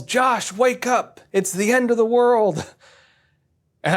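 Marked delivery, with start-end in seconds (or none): 0.0-2.7 high, urgent mock yell from mom; retold yell. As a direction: mom bursting in, calling out in alarm. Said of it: "and she yells".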